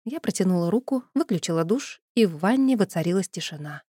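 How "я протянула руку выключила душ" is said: plain reading voice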